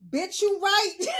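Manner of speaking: loud, high comic exclamation; hammed-up, high-pitched agreement; mock emphatic agreement. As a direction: blurt it out in loud concession. Imagined blunt agreement with mother.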